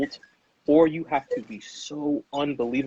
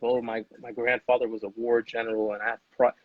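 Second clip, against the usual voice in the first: imitating proud heir; 3 semitones lower, 3 dB quieter than usual. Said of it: "I don't have the stories of".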